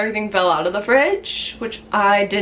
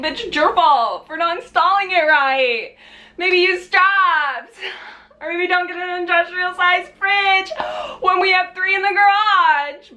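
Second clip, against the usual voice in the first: retold yelling; 9 semitones higher than usual. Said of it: "starts screaming at me".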